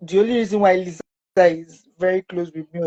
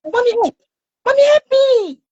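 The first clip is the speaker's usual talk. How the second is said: put-on very high, loud child's wail; imitated cry for help; 6 dB louder than usual. Son begging his mother during the injection, imitated screaming. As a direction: call out desperately to mommy, pitch shooting up. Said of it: "Small time, he will say".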